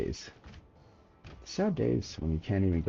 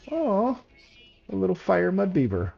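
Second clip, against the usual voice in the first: much higher, doting coo; 10 semitones higher than usual. Real, not acted. Streamer cooing at a cute fire creature, high doting voice. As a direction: coo over it like a puppy.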